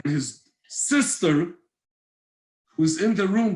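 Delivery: ordinary talk, nothing marked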